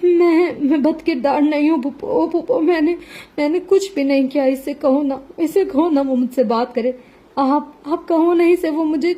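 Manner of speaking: high, breaking sobbing plea; desperate sobbing. A narrator's reading. Madiha begs her aunt to stop her husband, desperate sobbing. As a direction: beg through hiccuping sobs, pitch climbing high. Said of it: "اس کی ہچکیاں بن گئی".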